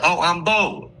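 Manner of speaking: high, mock-eager fan voice; lightly voiced stranger introducing himself; imitating the fan. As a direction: play the creepy fan brightly. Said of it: "They said".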